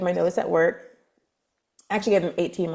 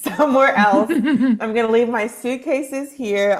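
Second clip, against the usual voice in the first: amused, louder retelling with laughter; laughing; 5 semitones higher, 5 dB louder than usual. Real, not acted. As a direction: laugh through your own dramatic announcement. Laughs retelling her sudden moves.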